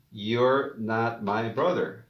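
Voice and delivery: slow, careful "you're not my brother"; slow model answer. Shown as a demonstration. Teacher to class, slowly modelling the answer.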